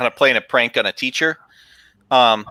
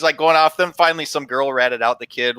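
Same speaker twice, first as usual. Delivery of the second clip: ordinary talk, nothing marked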